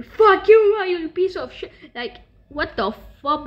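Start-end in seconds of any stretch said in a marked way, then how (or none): 0.0-1.7 sudden high, loud mock rage; imitated furious shout; hater's outburst. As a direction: burst out yelling as the angry hater. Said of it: "the other one saying".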